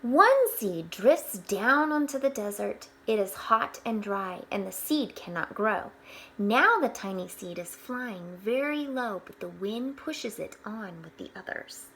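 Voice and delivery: bright storybook read-aloud; gentle storyteller voice; reading to children. Acted. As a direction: read it out like a picture book for the class. Reading picture book aloud to children.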